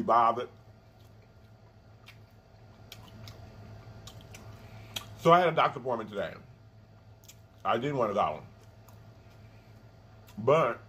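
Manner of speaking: chewing while talking; talking between bites; mouth full of food. Real, not acted. Seen 2: Bites at -9; lips pursed, chewing motions through the labelled words.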